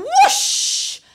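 loud, long whoosh; big drawn-out swooping whoosh; whoosh of take-off. A narrator's reading. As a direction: stretch the whoosh like a broom lifting off. Broomstick takes off once more.

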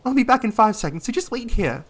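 hurried heroine voice. Acted. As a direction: rush the words as the heroine. Heroine hurrying off.

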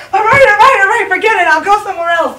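high, loud flustered surrender; loud exasperated exit. Acted. Customer abandons the bikini wax, loudly exasperated. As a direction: call it off loudly and bail out. Waxing customer gives up the scene.

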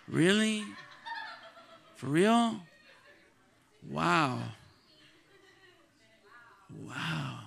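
mock disbelief, with long pauses between words; doubtful questioning. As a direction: imitate an unconvinced listener, one word at a time. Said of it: "You're saying it like a question".

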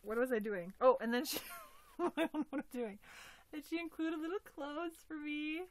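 usual speaking voice

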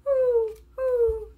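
two high hoots; owl hoots. A narrator's reading. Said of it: "How about an owl".

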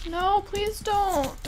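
high, slow desperate plea. Real, not acted. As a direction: beg him to stop. Enemy attacks.

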